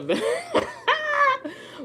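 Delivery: high squealing laugh burst. Real, not acted. Telling friends the ending, bursting into a high laugh.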